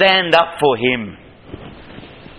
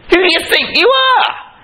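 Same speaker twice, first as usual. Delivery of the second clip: much higher, shouted challenge; angry shout. Retold or imitated. Eliab shouting at David, high angry shout. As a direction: blast the question at him.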